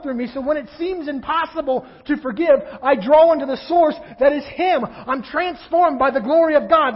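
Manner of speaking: loud, high, ringing sermon delivery; full-force preaching. Acted. Pastor preaching forgiveness to the congregation, at full force.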